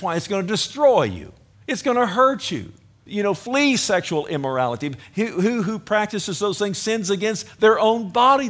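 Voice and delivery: preacher urging the congregation at full force; fervent preaching. Real, not acted. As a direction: press the warning home.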